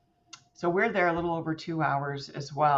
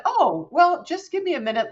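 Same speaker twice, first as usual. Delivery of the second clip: bubbly; 11 semitones higher, 6 dB louder than usual. Retold or imitated. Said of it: "all bubbly on the other end of the phone".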